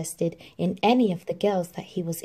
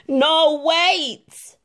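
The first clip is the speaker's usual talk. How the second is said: loud, higher call to her; calling after; 9 semitones higher, 8 dB louder than usual. Acted. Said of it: "the prince called after her".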